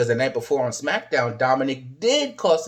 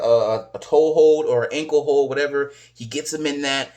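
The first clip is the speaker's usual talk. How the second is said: ordinary talk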